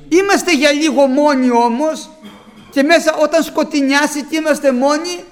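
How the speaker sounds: loud, high, fervent preaching; preaching at full force. Acted. Preacher urging the brothers, loud fervent preaching. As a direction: rouse the flock with the Word.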